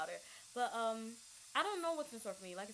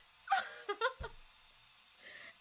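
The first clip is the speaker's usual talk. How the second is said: laughing whimper; 13 semitones higher than usual. Real, not acted.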